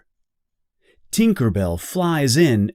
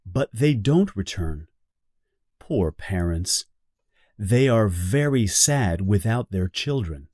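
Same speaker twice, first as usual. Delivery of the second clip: ordinary delivery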